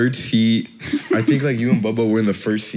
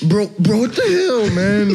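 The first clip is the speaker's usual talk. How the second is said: much higher, mock-outraged coach; angry coach squeak; 11 semitones higher than usual. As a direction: squeal the complaint like a miffed grown man. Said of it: "And he's like".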